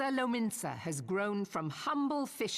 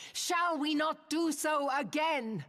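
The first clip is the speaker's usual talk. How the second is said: rallying cry; 9 semitones higher than usual. Acted. Admiral rallies crowd climactically.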